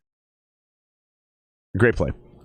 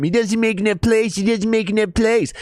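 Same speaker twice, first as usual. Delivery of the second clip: mocking haters; 13 semitones higher than usual.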